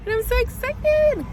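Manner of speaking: squealing high with excitement; excited. Real, not acted. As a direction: squeal it out, thrilled. Excited about lamp pickup.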